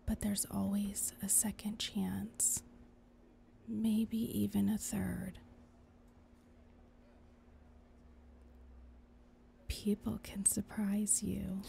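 soft unvoiced whisper. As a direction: whisper it like a conscience. Angel whispering in ear.

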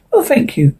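plain reading voice

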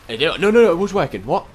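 high frustrated protest. Real, not acted.

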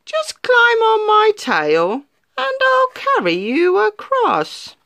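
high, honeyed sly fox; sly fox greeting the gingerbread man with a grin; grinning fox. Acted. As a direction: charm the little cookie with a grin. Fox offers a ride.

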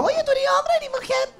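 high, prying voice of a stranger; mock a nosy stranger's question; nosy asker. Strangers quizzing the comedian in the street, high prying voice. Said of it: "la gente se pregunta así como".

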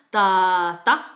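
"tata" with long first vowel, short second; long then short. Shown as a demonstration. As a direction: stretch the start of "tata", cut the end. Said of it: "second ma is very short".

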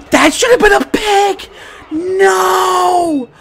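high, loud cry of protest; real frustrated yell; anguished shout. Real, not acted. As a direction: wail it in disbelief at the game. Anguished at missed interception.

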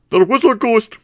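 high, loud desperate cry; frantic insistence. A narrator's reading. Barman insisting to boss, high desperate shout. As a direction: shriek it as if nobody believes you.

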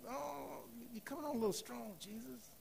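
high, very soft hedging voice; tactful mock reproach. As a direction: gingerly object in a small, high murmur. Acts out Peter gently rebuking Jesus.

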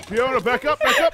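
high, loud warning call; urgent shout. Real, not acted. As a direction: yell at her to back up. Calling out warning in danger.

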